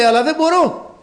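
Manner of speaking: high-pitched mock protest of a suffering man; retold pained cry. Allergic man answering his rescuer, imitated high helpless cry. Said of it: "δεν θέλω να φωνάζω".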